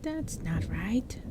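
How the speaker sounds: slow, scornful goblin voice; withering goblin critic. Acted. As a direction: sneer it slowly. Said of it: "said witheringly".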